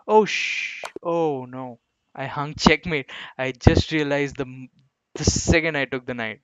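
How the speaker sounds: loud, startled dismay at a blunder; loud burst of horrified realization. Real, not acted. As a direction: blurt it out as the mistake hits you.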